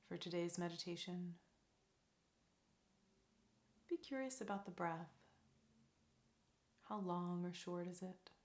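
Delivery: soft, calm meditation voice with long pauses; guided meditation. Real, not acted. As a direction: lead them gently into stillness, leaving space between phrases.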